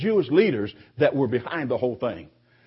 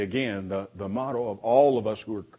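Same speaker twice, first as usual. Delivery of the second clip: normal talking voice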